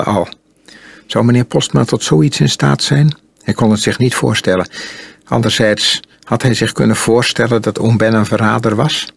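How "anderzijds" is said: usual speaking voice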